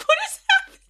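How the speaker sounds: high, loud disbelieving cry; shocked disbelief. Retold or imitated. Shocked reaction to dancing scene.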